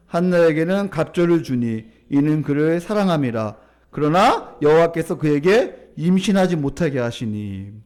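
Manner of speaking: low, loud, measured verse reading; scripture reading aloud. Real, not acted. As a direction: lead the congregation through the verse. Leads congregational scripture reading.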